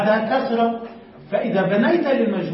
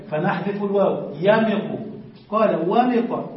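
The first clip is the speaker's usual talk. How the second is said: ordinary delivery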